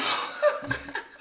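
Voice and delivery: a high, airless burst of laughter; wheezing laugh, no voice; breathless laugh. Real, not acted. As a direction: crack up at your own story. Laughing at own story.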